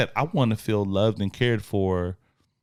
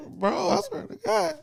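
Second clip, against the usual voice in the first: high, slow, half-laughing mock sob; playing himself tearing up at Free Willy; choked-up movie tears. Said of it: "I was like".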